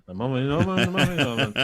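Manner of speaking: lightly mumbled wedding disco DJ; slurred cheesy DJ patter, barely understandable. Pretend. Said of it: "wedding disco disc jockey voice".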